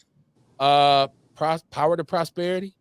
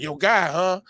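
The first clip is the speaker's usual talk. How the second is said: mocking Chicago voice; 6 semitones higher, 4 dB louder than usual. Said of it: "They'd be like".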